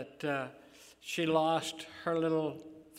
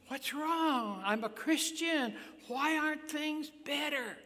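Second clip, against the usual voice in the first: whiny complainer; 10 semitones higher than usual. Retold or imitated. Imitating a complaining believer.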